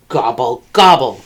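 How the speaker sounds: low, grumpy turkey gobble; grumbling gobble. A narrator's reading. Turkey sulking after Cow sees through him, low grumbled gobble. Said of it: "grumbled Turkey".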